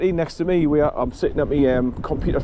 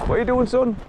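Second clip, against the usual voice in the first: higher sing-song voice for the dog; doggy talk; 9 semitones higher than usual. Real, not acted. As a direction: coo at the dog, pitched well up. Talking fondly to the dog.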